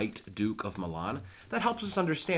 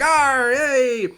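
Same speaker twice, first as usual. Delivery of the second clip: sailor shout; 13 semitones higher, 10 dB louder than usual. Acted. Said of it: "he's like".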